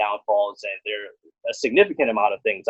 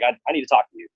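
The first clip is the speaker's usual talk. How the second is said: ordinary talk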